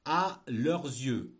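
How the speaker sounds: slow, loud dictation; dictating. Shown as a demonstration. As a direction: spell it out for the class. Teacher dictating a text.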